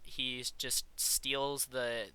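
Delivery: ordinary delivery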